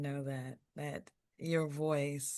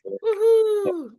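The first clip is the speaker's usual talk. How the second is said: high loud excited whoop; cheering whoop; 14 dB louder than usual. Real, not acted. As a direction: whoop it like a crowd. Cheering the poet.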